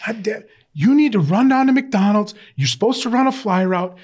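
high, loud mock tirade at a player; livid coach. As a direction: chew out the player like a fed-up coach. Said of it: "he was livid".

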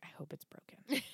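whispered spiteful aside; under her breath. Retold or imitated. Quoting Serena after the fake injury, muttered under her breath. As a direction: imitate a sneaky whisper. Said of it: "caught under her breath".

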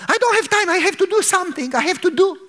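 high, hurried protest; rushed man who can't stop; impatient busy man. Acted. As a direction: brush him off, no time to sit. Hurried man refuses to sit.

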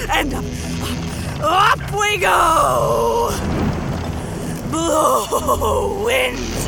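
high, loud declaiming into a storm; crazed scientist shouting over thunder; manic raving. Acted. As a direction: rage grandly at the winds, stretching the climaxes. Raving at the storm while hoisting.

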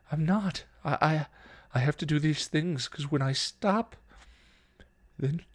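high, halting, distraught; tearful, rising desperation; starting to cry. A narrator's reading. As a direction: unravel as you explain, faltering. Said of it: "she started to cry".

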